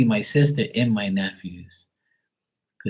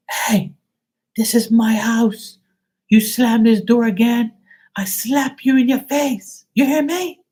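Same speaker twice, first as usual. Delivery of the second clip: high, louder mock-angry mom; mom scolding; 13 semitones higher, 5 dB louder than usual. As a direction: play the furious mother threatening a slap.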